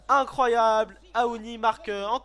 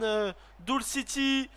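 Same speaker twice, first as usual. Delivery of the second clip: ordinary talk, nothing marked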